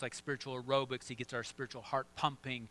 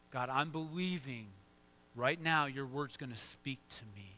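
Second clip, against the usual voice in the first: ordinary talk, nothing marked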